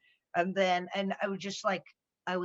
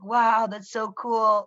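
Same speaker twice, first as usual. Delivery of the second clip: mock impressed; much slower than usual. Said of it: "it's like".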